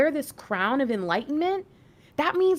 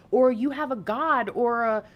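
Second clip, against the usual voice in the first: ordinary delivery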